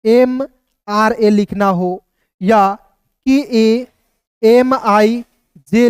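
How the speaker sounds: slow, letter by letter, with pauses; teacher dictating letters slowly; spelling out slowly. Shown as a demonstration. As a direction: spell each letter out for the class. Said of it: "बता रहा हूँ कि इसका उच्चारण कैसे करते हैं".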